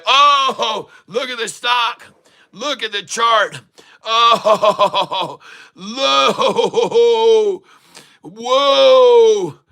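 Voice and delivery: high, whooping disbelief with laughter; elated cries breaking into laughter; ecstatic amazement. Real, not acted. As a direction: burst out overjoyed at the sight. Amazed by the surging chart.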